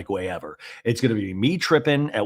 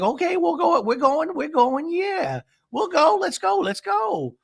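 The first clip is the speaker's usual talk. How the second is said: very high, stretched-out voice; mock hurried agreement; slower than usual. Recapper mimics friend leaving the bathroom, high mock agreement. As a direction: agree in an exaggerated squeal. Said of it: "And she's like".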